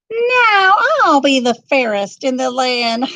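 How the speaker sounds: high, loud gloating queen; triumphant queen. A narrator's reading. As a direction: crow over her victory.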